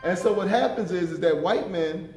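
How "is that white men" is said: ordinary talk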